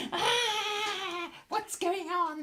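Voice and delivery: very high squealing goblin voices; cowering goblins. Acted. Goblins cowering before the booming flames, frightened squeals. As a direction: wail like tiny panicked creatures. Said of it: "The goblins cower in fear".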